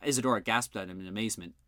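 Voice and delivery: usual speaking voice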